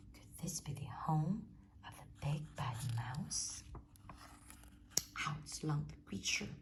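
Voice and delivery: ordinary delivery, nothing marked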